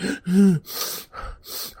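high, hammed-up tearful gasp; mock crying gasp. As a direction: gasp like someone fighting back tears. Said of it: "He's kinda like".

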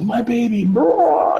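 high, frantic mock cry of a mother. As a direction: shriek it like a protective mother. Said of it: "protective freaking wolf mother mode".

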